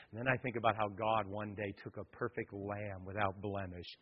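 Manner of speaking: tender quiet sermon passage; hushed preaching. Acted. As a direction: drop almost to a murmur to draw them in. Hushed vow and cross passage.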